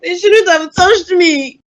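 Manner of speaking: high, slow, shouted cry of despair; wailing sob. Acted. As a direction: wail it out through tears, drawn out.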